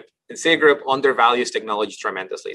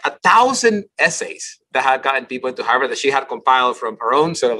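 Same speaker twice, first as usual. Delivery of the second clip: ordinary delivery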